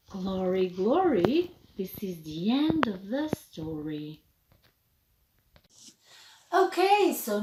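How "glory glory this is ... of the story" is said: low soft closing rhyme; storybook ending. A narrator's reading. Ending the story for children, soft and low. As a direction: close the story gently.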